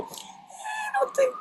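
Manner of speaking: very high, slow, doubtful squeak; squeaky skeptical voice. Retold or imitated. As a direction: squeak it out, unconvinced. Mock skeptical voice in the bit.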